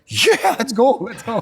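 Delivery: wife thrilled about the beach; gleeful yes. Retold or imitated. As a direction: jump at the chance. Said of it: "She's like".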